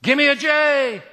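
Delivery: loud, high cheerleader call; Jesus cheer. Retold or imitated. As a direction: belt it out like a pep rally cheer. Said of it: "did a Jesus cheer".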